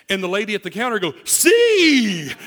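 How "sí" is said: high, loud imitation of an exclaimed yes; hammed-up shout of agreement, imitating someone; mock emphatic outburst. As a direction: burst out with a comically big, high cry. Mimics clinic lady's emphatic agreement.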